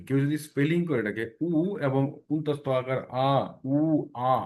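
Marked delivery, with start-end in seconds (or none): 3.7-4.3 উ, pause, আ as two separate vowels; spelled-out vowels. Shown as a demonstration. Teacher warning against spelling it out, separated vowels. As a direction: break উ and আ apart. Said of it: "বিষয়টা এরকম না কিন্তু".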